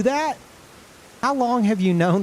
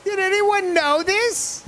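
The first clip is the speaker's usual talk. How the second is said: shocked disbelief; slower than usual. Real, not acted. Streamer stunned by a hidden game mechanic, high incredulous cry. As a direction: react like you just can't believe it.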